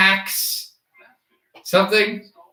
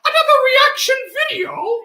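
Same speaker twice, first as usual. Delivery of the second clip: very high, squeaky laughing question; squealing laugh. Real, not acted. Mocking own impression, laughing.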